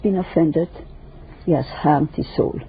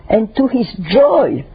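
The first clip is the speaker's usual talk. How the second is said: joy said slow and high; stress on joy; 4 semitones higher, 4 dB louder, slower than usual. Shown as a demonstration. Speaker stresses joy to listeners, slow and high. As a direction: lean hard on "joy", slowly. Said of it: "let me emphasize the word joy".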